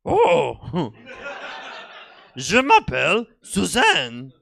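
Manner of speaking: sing-song like a French lesson recording; French tape lady. Retold or imitated. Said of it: "the lady on the tape said".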